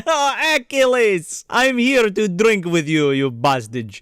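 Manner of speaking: hammed-up Russian accent, starting high and settling; mock a Russian villain's hearty greeting. Alexander greets Achilles in the bar, mock Russian accent. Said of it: "'Cause he's Russian".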